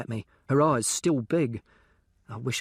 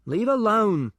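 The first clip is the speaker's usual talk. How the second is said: shouting up out of the grave, louder; calling up; 10 semitones higher, 5 dB louder, slower than usual. Acted. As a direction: call it up to him, loud and high. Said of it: "I call up".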